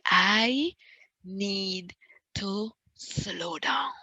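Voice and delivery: each word drawn out with pauses between; slow right down, word by word; slow, deliberate. Shown as a demonstration. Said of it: "Type it slowly".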